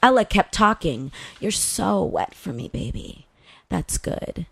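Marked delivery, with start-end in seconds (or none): 1.4-4.4 a soft, low murmur fading to a whisper; murmuring softly. Retold or imitated. Girlfriend murmuring softly to her in the trunk. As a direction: say it quietly, close and intimate. Lover murmuring during sex.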